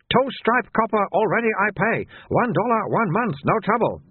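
high and insistent; high shopkeeper insisting he already pays; stubborn shopkeeper. Acted. Soon repeating himself.

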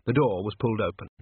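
ordinary delivery, nothing marked

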